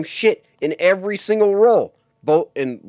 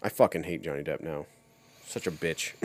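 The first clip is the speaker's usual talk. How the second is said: muttered grumble; 10 semitones lower, 11 dB quieter than usual. Real, not acted. Grumbling about the actor.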